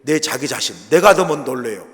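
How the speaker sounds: ordinary talk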